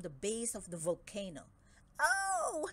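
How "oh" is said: high startled yelp. Acted. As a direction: yelp in sudden pain. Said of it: "yelped Dragodad".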